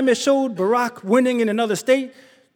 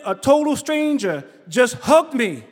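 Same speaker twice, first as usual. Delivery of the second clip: ordinary talk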